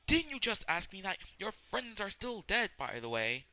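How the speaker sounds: high, loud exasperated retort; mocking villain. Villain reminding about the dead friends, high mocking shout. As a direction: shoot it back high and loud, then settle. Acts out villain's spoken aside.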